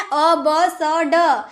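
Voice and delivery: "अ ब स ड" slowly, each letter sound on its own; phonic sounds. Shown as a demonstration. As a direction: recite "अ ब स ड" spaced out as open sounds. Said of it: "क्या पढ़ना है?".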